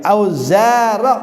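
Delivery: ordinary talk, nothing marked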